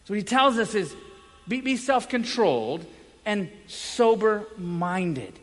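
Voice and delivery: usual speaking voice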